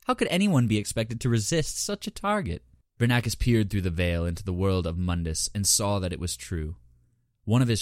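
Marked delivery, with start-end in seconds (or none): 0.1-2.6 higher, silky and mocking; purring. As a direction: purr it with amusement. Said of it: "Horavatha purred".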